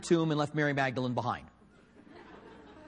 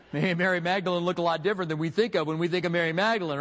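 ordinary talk

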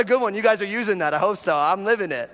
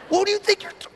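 very high mock scolding; hammed-up outraged Italian aunt from New York; faster than usual. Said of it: "Your aunts".

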